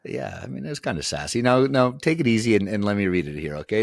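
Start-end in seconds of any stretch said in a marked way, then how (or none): none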